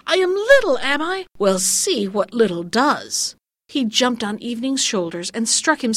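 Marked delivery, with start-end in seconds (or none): 0.1-3.3 indignant threat. Acted. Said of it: "he cried".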